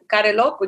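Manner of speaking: ordinary delivery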